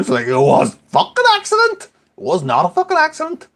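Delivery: rising to a high, loud indignant cry; indignant outburst. Retold or imitated. A dad re-enacting his outrage at his son. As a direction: protest that it was no accident. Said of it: "angry retort".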